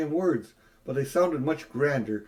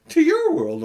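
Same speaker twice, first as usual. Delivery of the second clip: high, loud surprised exclamation; as if it were obvious; 8 dB louder than usual. A narrator's reading. Jadis answers in surprise.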